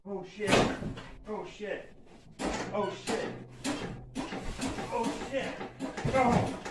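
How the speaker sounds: strained gasps while lifting; straining under weight. Real, not acted. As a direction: grunt it out under the load. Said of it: "this one's heavy".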